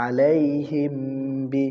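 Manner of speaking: "আলাইহিম বি" chanted with a hummed m before b; ikhfa. Shown as a demonstration. Teacher models ikhfa for students, "আলাইহিম" m held before "বি". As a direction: hum the m of "আলাইহিম", then release into "বি". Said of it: "ইকফায়ে স্বাভাবিক".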